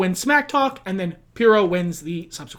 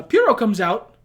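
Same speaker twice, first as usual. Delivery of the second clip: ordinary talk, nothing marked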